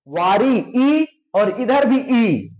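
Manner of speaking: वारी slowly, then each ई held long; sounded-out matching vowels. Shown as a demonstration. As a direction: draw out ई both times to show they match. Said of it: "वारी बोल के देखो".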